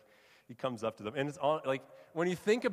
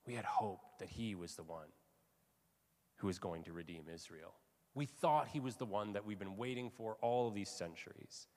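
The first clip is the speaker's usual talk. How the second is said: hushed, much softer, crestfallen; quiet devastation; 2 semitones lower, 8 dB quieter than usual. Acted. Disciples telling of their lost hope, quietly devastated. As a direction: say it softly, as if hope is gone. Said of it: "The devastation that these followers of Jesus are feeling".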